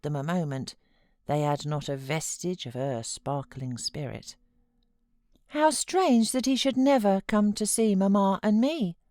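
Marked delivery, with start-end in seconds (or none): 5.5-9.0 put-on murmuring boy. A narrator's reading. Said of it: "he murmured".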